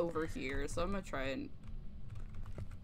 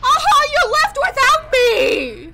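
long, high drawn-out call; sing-song wail; 15 dB louder, slower than usual. Pretend. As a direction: wail it teasingly, slowly. Left behind in truck.

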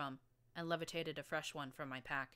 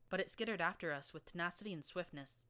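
ordinary delivery

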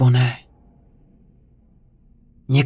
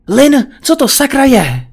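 raised, higher-pitched annoyed demand; angry snap; 10 semitones higher, 5 dB louder than usual. A narrator's reading. Husband confronting wife through the bathroom door, angry snap. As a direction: lash out at her, louder and higher. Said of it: "Vyjel jsem na ni".

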